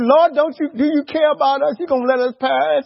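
loud, high acted alarm; panicked disciples. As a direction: plead frantically over a storm. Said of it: "They said".

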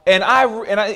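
usual speaking voice

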